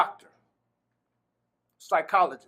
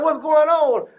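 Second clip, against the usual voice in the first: calling out; 7 semitones higher, 6 dB louder, slower than usual. Retold or imitated. Guard asks again, loudly.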